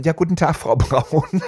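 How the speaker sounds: a light hint of a loud, cheery salesman; wine salesman. Wine salesman on the phone, lightly voiced salesman. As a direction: greet like a pushy salesman. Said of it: "so ein Weinvertreter am anderen Ende".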